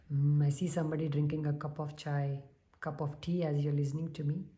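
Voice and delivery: usual speaking voice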